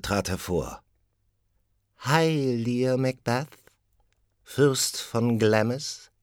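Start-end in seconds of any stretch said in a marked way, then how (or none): 2.0-6.1 high, slow, drawn-out witch greeting; eerie witch. Acted. First witch hailing Macbeth, high and drawn out. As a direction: hail him like an ominous prophet.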